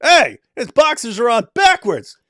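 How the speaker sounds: high, loud mocking call; yelled taunt. An inmate yelling at him in the hallway, loud mocking call. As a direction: call it out across the hall, slow and loud. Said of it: "someone yells".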